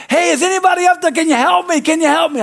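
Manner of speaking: high, loud cry for help; yelling up. Acted. Man hanging on a branch calling for help, yelling up. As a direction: holler desperately up a cliff, hammed-up. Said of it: "they're yelling up".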